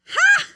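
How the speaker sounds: high, explosive bark of laughter; shocked loud "ha". Real, not acted. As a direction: burst out with a sharp, high "ha!". Reads shocking question card.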